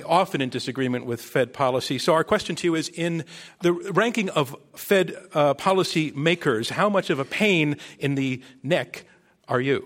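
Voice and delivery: usual speaking voice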